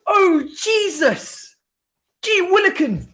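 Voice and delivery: high, drawn-out theatrical gasps of disbelief; hammed-up mock shock. Watching Casemiro get sent off, mock-scandalised high drawn-out exclamations. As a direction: play a scandalised old-timer clutching his pearls.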